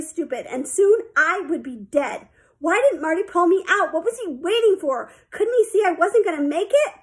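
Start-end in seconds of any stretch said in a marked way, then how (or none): 2.6-6.9 higher, exasperated desperate questions. A narrator's reading. Sheila sure she will sink, desperate. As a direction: pile up the questions in rising panic.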